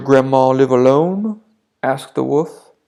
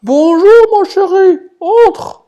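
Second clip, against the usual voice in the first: very high, loud put-on granny voice; a wolf squeaking like a sweet granny; wolf faking grandma; 9 dB louder, slower than usual. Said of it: "essaya d'imiter la petite voix de grand-mère". (le loup imite grand-mère, voix très aiguë et forte)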